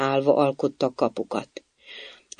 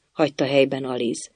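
ordinary delivery, nothing marked

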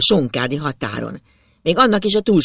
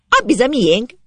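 high, louder exclamation; girl's cry; 9 semitones higher, 5 dB louder than usual. A narrator's reading. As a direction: cry it out as the excited girl. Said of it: "azt kiáltja Zsuzsi".

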